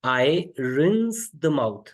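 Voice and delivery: very slow, clear word by word; slow teaching model. Shown as a demonstration. Teacher models an English phrase for Hindi learners, very slow. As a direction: spell it out slowly for learners. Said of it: "rinse the mouth मतलब कुल्ला करना".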